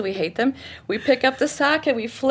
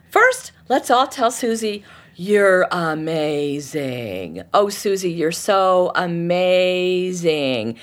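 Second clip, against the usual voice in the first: slow, drawn-out mock adoration; sarcastic praise; much slower than usual. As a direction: lay the sarcasm on thick and slow.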